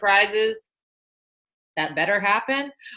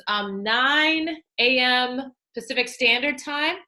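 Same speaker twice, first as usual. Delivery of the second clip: ordinary talk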